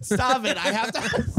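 laughing high protest; being tickled. Real, not acted. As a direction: giggly squeal.

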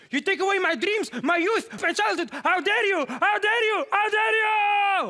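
high-pitched, hammed-up outraged shouting; Greta Thunberg. Comedian mimicking Greta's speech, mock outraged shouting. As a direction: screech the speech, dragging out the last words. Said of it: "hebben jullie die speech van haar gezien?".

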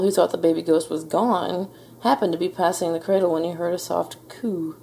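usual speaking voice

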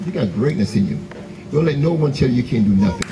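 usual speaking voice

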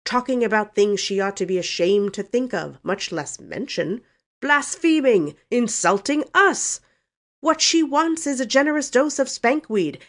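loud, high-pitched blustering uncle; indignant snorting. A narrator's reading. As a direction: rant in outrage, hitting words hard. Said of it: "snorted Uncle Benjamin".

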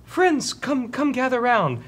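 high-pitched summons to friends; frail orc prisoner. Acted. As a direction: beckon them in a falsetto voice. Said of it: "And he says".

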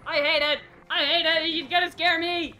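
loud, high frightened cries; scared yelling. Real, not acted. Streamer spooked by the creature, yelling scared. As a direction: yell out in real fear.

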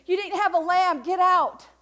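a stern imagined God sending them off; mock harsh dismissal. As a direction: bark it like a gatekeeper turning them away. God voiced rejecting harshly.

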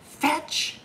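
ordinary delivery, nothing marked

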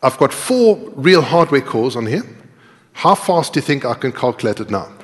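ordinary talk, nothing marked

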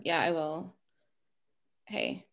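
normal talking voice

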